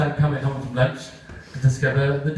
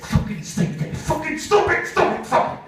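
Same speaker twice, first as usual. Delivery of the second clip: frustrated swearing; 14 semitones higher than usual. Retold or imitated. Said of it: "Kevin is doing this".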